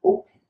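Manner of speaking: "opened" ending straight from N into the final consonant; E left silent. Shown as a demonstration. Teacher models the same rule on a new word, E silent. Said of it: "The same thing with".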